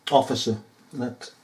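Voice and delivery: normal talking voice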